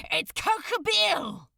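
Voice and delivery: high, loud shout; shouting over noise. Acted. Crowley shouting into the angel's ear over the chaos, loud high shout. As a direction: shout it straight into his ear over the din. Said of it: "He shouted into the angel's ear".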